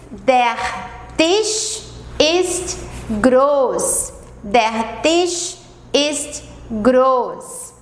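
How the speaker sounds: der Tisch ist groß, said very slowly and clearly; slow model sentence. Shown as a demonstration. Teacher to Brazilian students, slow clear German model sentence. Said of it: "Vou ler mais uma vez as quatro frases".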